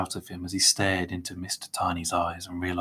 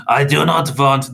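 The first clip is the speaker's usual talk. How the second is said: loud, higher heated protest; indignant, emphatic refusal; insistent; 6 semitones higher, 10 dB louder than usual. A narrator's reading. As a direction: insist forcefully until cut off. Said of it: "Mister Crepsley insisted".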